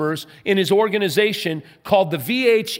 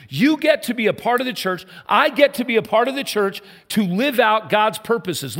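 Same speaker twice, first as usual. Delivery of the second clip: raised, ringing pulpit voice; rousing sermon delivery; fervent preaching; 5 semitones higher than usual. Acted. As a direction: proclaim it to the whole congregation. Preaching at full force.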